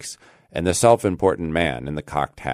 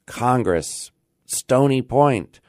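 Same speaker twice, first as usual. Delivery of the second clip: usual speaking voice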